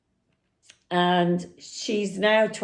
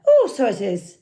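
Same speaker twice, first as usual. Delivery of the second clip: higher, quicker mock Irish accent; 4 semitones higher, 5 dB louder, faster than usual. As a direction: put on an amateur Irish lilt, pitched up. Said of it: "I can't do an Irish accent, so excuse me".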